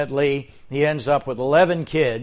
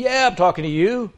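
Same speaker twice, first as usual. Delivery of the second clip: higher frog voice, a bit pushy; talking frog; 6 semitones higher, 4 dB louder than usual. Acted.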